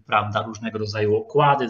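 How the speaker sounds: ordinary talk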